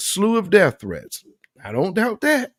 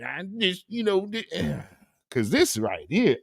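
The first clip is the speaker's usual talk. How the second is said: mock apologetic parent; 3 semitones lower than usual. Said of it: "this, this is".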